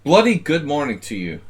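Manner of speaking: bad British accent, shouted; mock British accent. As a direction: belt it out like a cheery Brit, badly. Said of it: "my British accent needs a lot of work".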